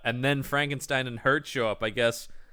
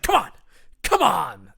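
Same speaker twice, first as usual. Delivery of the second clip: crazed goading; 4 semitones higher, 5 dB louder than usual. Retold or imitated. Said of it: "Now he's fully crazed".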